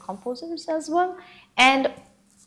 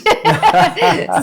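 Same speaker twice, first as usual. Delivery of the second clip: loud high laugh; 13 semitones higher, 11 dB louder than usual. Real, not acted. Laughs after praise.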